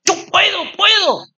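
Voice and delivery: high, strained pep talk, acted out; self-directed anger. Pastor furious with his own fear, high mock-angry resolve. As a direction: psych yourself up in mock rage. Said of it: "me vino una rabia conmigo mismo".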